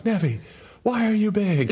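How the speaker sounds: high, laughing call to the next room; calling to the other room in mock dismay; mock yell. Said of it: "I yelled at Nevi".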